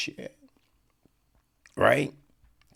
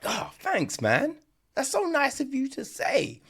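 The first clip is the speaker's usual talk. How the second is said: much higher, put-on thankful voice; oblivious gratitude; 13 semitones higher than usual. Insulted people thanking their insulter, high and oblivious. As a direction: gush thanks in a high mock voice.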